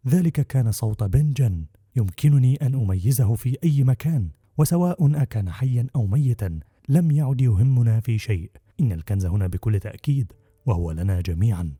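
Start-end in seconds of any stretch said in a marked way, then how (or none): none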